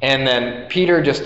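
normal talking voice

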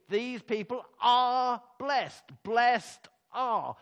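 usual speaking voice

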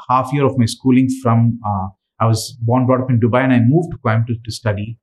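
ordinary delivery

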